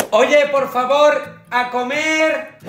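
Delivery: mother calling to eat. Acted. Madre llama a comer.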